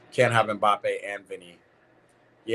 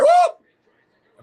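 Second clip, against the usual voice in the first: high whooping cheer with a laugh; excited whoop; 13 dB louder than usual. Real, not acted. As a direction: shout it out in delight. Goal chance, excited cheer.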